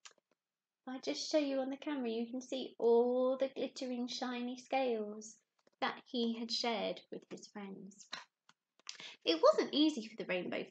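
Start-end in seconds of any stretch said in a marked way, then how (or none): none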